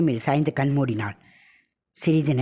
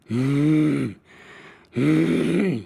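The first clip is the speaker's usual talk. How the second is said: growling hum through the nose; lightly voiced demonic nasal growl; possessed growl; much slower than usual. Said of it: "கர்ஜனையுடன் சத்தம் மூக்கின் வெளியே வந்தது".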